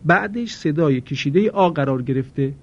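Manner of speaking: usual speaking voice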